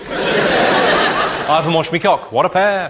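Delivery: smug, slow comeback; slow cheeky retort. Retold or imitated. Comic answers the fan's boast, slow and smug.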